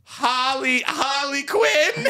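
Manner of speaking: very high, stretched cartoon tri-state voice; Harley Quinn. Shown as a demonstration. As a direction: squeak it out slowly, feeling for the character. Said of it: "let me find it".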